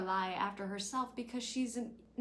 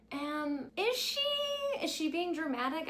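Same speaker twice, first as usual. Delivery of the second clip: incredulous question; 8 semitones higher than usual. Real, not acted. Vlogger asking viewers if Rory is dramatic, high and incredulous. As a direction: ask it in mock disbelief. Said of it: "rhetorical question to viewers".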